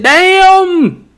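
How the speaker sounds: very high stretched "Damn"; Chris Tucker damn. Pretend. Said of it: "Forget how I said".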